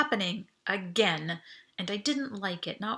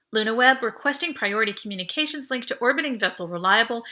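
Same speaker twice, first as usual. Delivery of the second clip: louder, crisp voice-command read; formal comm request; 2 semitones higher, 6 dB louder than usual. Acted. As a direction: speak clearly to the machine.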